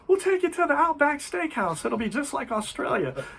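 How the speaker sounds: high-pitched imitation of eager family; well-meaning relatives. Family offering visiting couple a treat, mock-eager. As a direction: pitch it up as a naively helpful relative. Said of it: "God bless the family".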